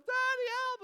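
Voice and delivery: put-on loud, very high panicked child; little girl calling. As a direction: imitate a small child yelling for dad. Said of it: "all of a sudden I hear".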